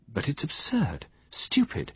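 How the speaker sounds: high, sharp rise on "stupid"; exasperated cry. A narrator's reading. Pamela scornful to Poirot, exasperated and high. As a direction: scoff at the crime. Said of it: "cried Pamela".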